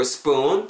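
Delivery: "spoon" with the oo drawn out long; long vowel. Shown as a demonstration. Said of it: "좀 길게 빼주세요".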